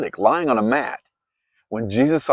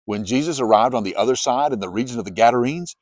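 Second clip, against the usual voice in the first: usual speaking voice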